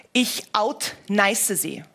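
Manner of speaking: usual speaking voice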